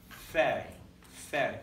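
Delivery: "fe" with a soft breathy f, no pop; gentle f sound in "fe". Shown as a demonstration. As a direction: let the air flow through for "fe". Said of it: "it's gonna make a softer".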